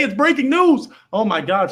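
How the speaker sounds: loud, high excited announcement; excited shout. Acted. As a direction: hype it like breaking news. Hyped announcement on live show.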